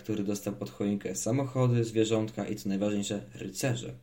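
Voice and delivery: plain reading voice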